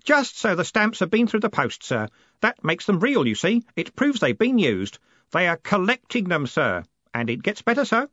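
put-on high, excited boy's voice; keen, breaking the amazing news; eager Stanley. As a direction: bubble over with news in a put-on boyish voice.

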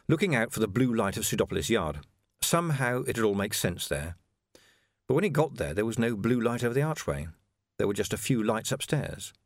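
ordinary delivery, nothing marked

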